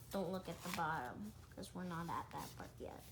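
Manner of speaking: quiet quick aside; hushed aside. Real, not acted. Kid quietly warns listeners not to peek. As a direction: murmur it to the listener.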